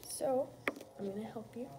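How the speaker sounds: ordinary delivery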